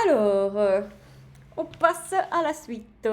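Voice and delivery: loud, slow showy host announcement; loud drawn-out announcement of the next part; big announcement. Real, not acted. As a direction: boom out the next section like a game show host.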